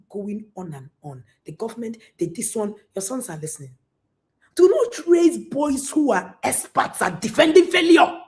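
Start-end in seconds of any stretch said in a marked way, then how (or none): 4.5-8.2 loud, driving preacher's warning; loud exhortation. Real, not acted. Preacher warning parents, loud and driving. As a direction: hammer it home to the parents.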